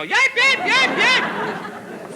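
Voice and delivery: high yelps of a hurt dog; dog yelping. Acted. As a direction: yelp like a shot dog. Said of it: "the dog went howling out".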